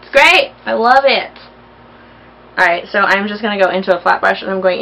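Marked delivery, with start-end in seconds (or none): 0.0-1.3 bursting out high and loud; high excited exclamation. Real, not acted. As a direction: gush over it. Excited repeat of praise.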